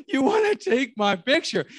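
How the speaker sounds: high, loud, taken-aback question; retold incredulous question. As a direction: act out being amazed at the request. Retelling his reply to stranger.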